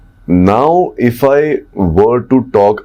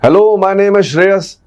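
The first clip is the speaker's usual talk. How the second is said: much higher, strained calling-out voice; shouty; 11 semitones higher than usual. Shown as a demonstration. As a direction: push the pitch way up as if shouting. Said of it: "don't shout".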